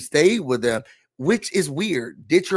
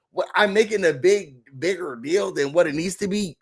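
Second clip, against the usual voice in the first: ordinary talk, nothing marked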